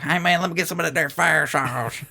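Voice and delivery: pranking the Taco Bell cashier, playing a country yokel. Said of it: "I was like".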